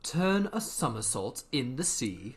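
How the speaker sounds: ordinary delivery, nothing marked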